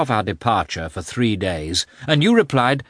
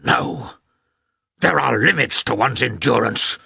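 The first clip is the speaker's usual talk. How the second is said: exclaimed; 4 semitones higher, 4 dB louder than usual. A narrator's reading. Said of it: "he exclaimed".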